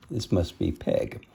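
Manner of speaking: ordinary delivery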